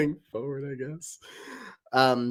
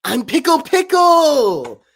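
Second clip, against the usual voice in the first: higher, louder, slow shouted catchphrase; Rick-style pickle shout; 10 semitones higher, 11 dB louder, slower than usual. Acted. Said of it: "that Rick way".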